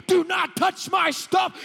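high, slow shouted order, every word driven hard; authoritative command. Retold or imitated. Pastor quoting his wife driving off the spirit, authoritative shout. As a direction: retell it as a thundering command. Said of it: "when you spoke out of authority".

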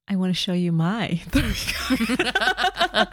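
slow line collapsing into giggles; breaking into laughter. Pretend. Co-host finishing the whispered sentence as a joke, laughing. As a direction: crack up before getting the words out. Joking completion of unheard sentence, cracking up.

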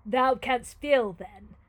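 slow, loud, lordly retort; pointed retort. Acted. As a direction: draw it out as a tyrant's taunt. Manfred taunts the pleading father.

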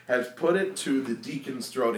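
usual speaking voice

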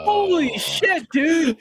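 drawn-out, high-pitched shout; real stunned shout. Real, not acted. Player reacting to friend's terrible roll, high stunned shout. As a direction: cry it out in disbelief.

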